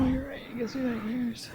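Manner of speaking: soft breathy whisper. Real, not acted. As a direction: whisper it to the side.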